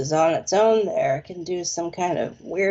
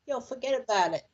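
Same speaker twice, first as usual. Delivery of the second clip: softer, lightly done Brooklyn; Brooklyn accent; 3 semitones higher, 6 dB quieter, faster than usual. Shown as a demonstration. Showing Richard how Brooklyn sounds, light Brooklyn accent. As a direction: toss it off with a touch of Brooklyn. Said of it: "try to talk Brooklyn".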